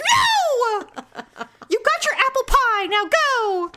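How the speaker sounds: high mock-scolding mom; hammed-up motherly refusal; angry mother. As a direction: cut the kid off as a fed-up mom. Voices furious mother refusing child.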